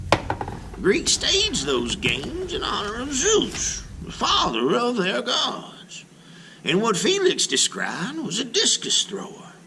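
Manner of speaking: a hint of a kindly grandmother; lightly voiced grandma. Grandma explaining the Greek games to Sophie, lightly voiced. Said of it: "says Grandma".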